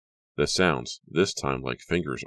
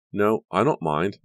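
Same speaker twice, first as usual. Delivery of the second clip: slow, reluctant, higher and louder; reluctantly; 5 semitones higher, 6 dB louder than usual. A narrator's reading. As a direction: give in slowly, unwilling. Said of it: "Leah said reluctantly".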